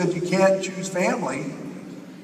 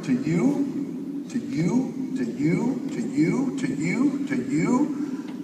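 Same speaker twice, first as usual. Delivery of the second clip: fading repetition; 5 dB quieter, much slower than usual. Acted. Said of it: "I can't say six thousand U's with my throat".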